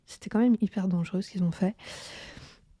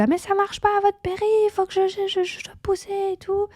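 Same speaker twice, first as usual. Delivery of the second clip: much higher, fast panicky plea; panicked; 11 semitones higher than usual. Retold or imitated. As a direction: panic that she has to push. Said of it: "J'étais".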